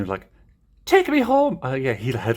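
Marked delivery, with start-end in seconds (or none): 0.8-1.6 high, loud Irish plushie voice; Irish accent. Acted. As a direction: plead shrilly in an Irish accent. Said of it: "like, an Irish accent".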